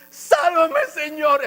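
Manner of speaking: clamor agudo, exagerado; imítate clamando a Dios con la voz muy arriba; grito de súplica actuado. Retold or imitated. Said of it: "Así le hago yo". (súplica aguda y teatral)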